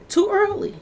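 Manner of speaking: "Too early" flung out high and incredulous; outraged disbelief, high; shocked exclamation. Real, not acted. Exclaims, then repeats lower.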